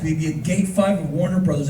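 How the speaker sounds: usual speaking voice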